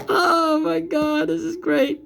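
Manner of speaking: very high, slow, giggly delight; squealing with amused glee; delighted mockery. Real, not acted. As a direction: gush with mock delight through a laugh. Delighted mockery after laughing.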